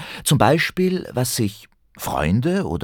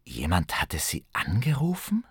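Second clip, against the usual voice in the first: whispered, puzzled thought; confused whisper; 5 dB quieter than usual. Acted. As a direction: breathe it out, bewildered. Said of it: "dachte Ben verwirrt".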